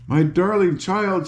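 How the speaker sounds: high, joyful cry of relief; a relieved aunt crying out; overjoyed aunt calling out; cried out. A narrator's reading. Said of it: "she cried".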